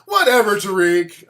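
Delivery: loud mock brush-off; dismissive brush-off. The guests brushing off his FBI facts, mock loud dismissal. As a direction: wave him off loudly. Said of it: "I'm like".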